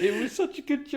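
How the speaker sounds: high-pitched, defending it through laughter; laughing protest. Real, not acted. Joke teller defending his joke, laughing. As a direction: squeal it out mid-laugh.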